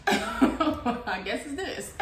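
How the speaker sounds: slow, laughing through words. Real, not acted. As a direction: laugh it out slowly. Laughing at own joke.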